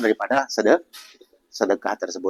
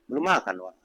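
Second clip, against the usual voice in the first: ordinary talk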